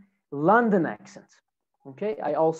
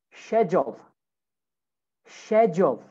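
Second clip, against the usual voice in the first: British "schedule" starting with SH; British accent. Shown as a demonstration. Teacher models British "schedule" for students, SH at the start. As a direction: say "schedule" as British "shed-yool". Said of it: "In British English".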